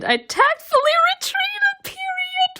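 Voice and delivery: very high playful squeak; squeaky high voice. Real, not acted. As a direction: squeak it like a giddy fan. Giddy shipping commentary.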